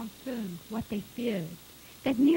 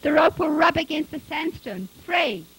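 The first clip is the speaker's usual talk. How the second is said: loud, raised, worried objection; urgent protest; 5 semitones higher, 7 dB louder than usual. A narrator's reading. Son warning his father about the rope, urgent. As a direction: argue hard against a risky plan.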